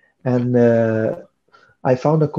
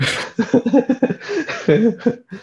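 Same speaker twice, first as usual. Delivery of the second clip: laugh; 12 semitones higher than usual. Real, not acted. Laughing at own cold-weather complaint.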